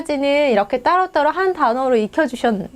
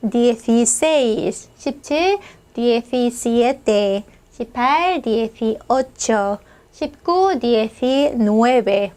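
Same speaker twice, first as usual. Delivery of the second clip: dieciséis, diecisiete drawn out very slowly, syllable by syllable; slow model reading; much slower than usual. Shown as a demonstration. Teacher reads numbers very slowly for learners. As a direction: stretch each number out for the class. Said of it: "한번 읽어볼까요?".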